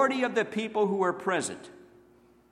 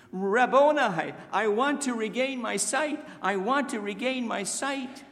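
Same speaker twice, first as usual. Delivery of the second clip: pleading beggar; 6 semitones higher than usual. Retold or imitated. Blind man pleading to Jesus.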